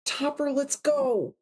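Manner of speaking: high, slow pleading; begging. Acted. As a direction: beg him to leave. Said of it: "I begged".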